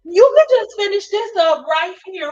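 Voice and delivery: very high, loud mock indignation; imitating an exasperated friend blowing up; fed-up outburst. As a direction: announce a dramatic walkout, loudly. Friend Stacy erupts and leaves.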